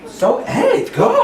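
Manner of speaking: high, loud bossy orders; bossy yell. Acted. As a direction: bark orders with a laugh. Acted boss ordering staff.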